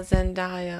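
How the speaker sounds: soft Zendaya, zen-DIE-uh. Shown as a demonstration. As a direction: gently correct to Zendaya with an "eye" in the middle.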